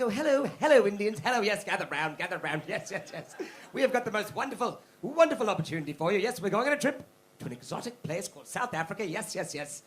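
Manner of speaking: hammed-up toff selling a dream; posh British colonial recruiter. Comic imitating British recruiters luring Indians, posh pitch. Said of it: "it's like".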